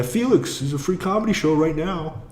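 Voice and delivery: mock street-barker invitation; imitating a barker. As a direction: lure a stranger in with a cheesy call.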